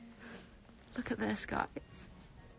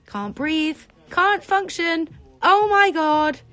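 high, building to a loud drawn-out cry; overwhelmed, getting louder with each phrase; overwhelmed excitement; 6 semitones higher, 6 dB louder, slower than usual. Real, not acted. Streamer reacting to a climbing follower count, overwhelmed.

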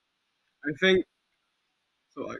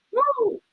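very high short whoop. Real, not acted. Player startled mid-game, whooping high. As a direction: squeal out a whoop.

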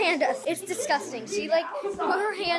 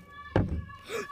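sharp scared gasp; 12 semitones higher than usual. Real, not acted. Seeker approaching the closet.

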